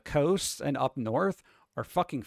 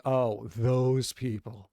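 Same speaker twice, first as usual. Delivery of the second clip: snooty disdain; 4 semitones lower, much slower than usual. Retold or imitated. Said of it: "raised to be like".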